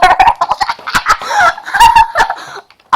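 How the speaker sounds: shrieking laughter; head-in-the-crayon-box cackle; loud high laughter. Real, not acted. Girl collapsing into crayons, shrieking with laughter. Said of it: "I was laughing so hard".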